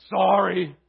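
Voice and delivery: a flat, grudging mumble of an apology; sulky kid forced to apologise; insincere sorry. Retold or imitated. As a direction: say sorry just because you were told to. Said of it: "that child didn't mean really that he was sorry".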